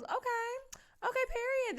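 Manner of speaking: high-pitched, excited hype; squealed, pleased okay; delighted approval. Real, not acted. Delighted reaction to the cards drawn.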